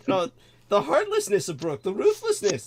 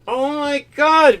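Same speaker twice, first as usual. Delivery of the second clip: dismayed oh my god; 7 semitones higher, 5 dB louder, slower than usual. Real, not acted. Reacting to stroke worry.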